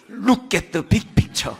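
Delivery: put-on loud, deliberate comeback in Korean-accented English; defiant inner retort. Pastor answering friends who mock his English pronunciation, loud deliberate retort. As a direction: punch out each English word as a retort. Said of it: "제가 마음으로 이렇게 대답하죠".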